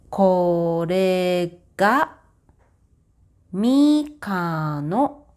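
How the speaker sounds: slow, deliberate Japanese example sentences; careful model reading. Shown as a demonstration. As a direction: spell out each phrase for learners.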